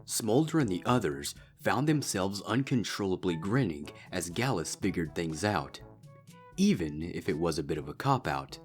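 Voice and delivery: ordinary delivery, nothing marked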